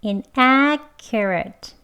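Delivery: inaccurate slowly with ac strongest, highest and longest; stress on ac. Shown as a demonstration. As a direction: lean hard on ac in inaccurate. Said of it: "ac를 가장 강하게 높게 길게".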